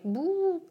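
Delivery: high rising "woo" of spreading bubbles; imitated whoosh. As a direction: swoop up high on a playful woo.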